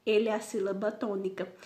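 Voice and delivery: usual speaking voice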